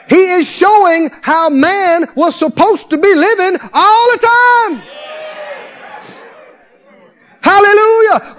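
full-throated pulpit preaching; preaching at full force. Acted. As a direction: lift the congregation, voice climbing up.

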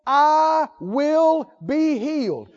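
drawn-out, firm statement of faith; slow affirmation. Acted. Preacher quoting the woman's vow, very slow and firm. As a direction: stretch it, mean every word. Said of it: "She said to herself".

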